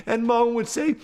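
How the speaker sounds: ordinary delivery, nothing marked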